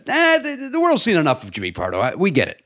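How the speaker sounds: imitated brush-off, opening high; dismissive quote. Comic mimics executive who has seen enough of him, dismissive. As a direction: wave him away, starting on a high "eh". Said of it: "Like that".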